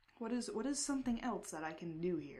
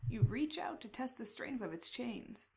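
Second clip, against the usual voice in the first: ordinary talk, nothing marked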